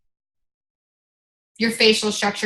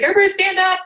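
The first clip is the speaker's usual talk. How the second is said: higher hyped-up call to stand; rally the crowd like Tony Robbins; Tony Robbins shouting; 8 semitones higher, 3 dB louder, faster than usual. Retold or imitated. Coach calling the crowd to stand, hyped-up. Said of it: "he's like".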